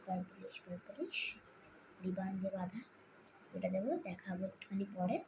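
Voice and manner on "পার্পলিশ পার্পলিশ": soft whispered word. Real, not acted. Describing mum's secret gift ribbon, whispering.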